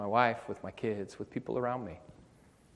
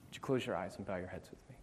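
altar call. Acted. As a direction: gently invite the weary to come home. Altar call, quiet invitation.

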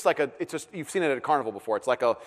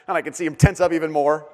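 usual speaking voice

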